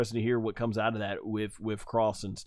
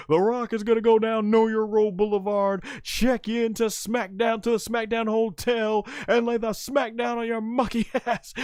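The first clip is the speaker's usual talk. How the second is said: high, declaimed wrestling promo; The Rock; 10 semitones higher than usual. Retold or imitated. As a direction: imitate a boastful wrestler working the crowd. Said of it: "when The Rock's like".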